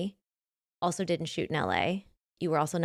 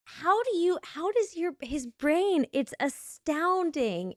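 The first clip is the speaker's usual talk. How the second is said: high, astonished mock disbelief; amazed; 10 semitones higher, slower than usual. Telling a friend how her husband amazes her, high amazed disbelief. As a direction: marvel at his brain.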